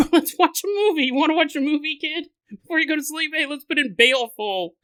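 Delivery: high, hammed-up cheery dad; mock sing-song dad to toddler; playful dad voice. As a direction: coax a toddler to bed. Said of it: "picking 'em up and going".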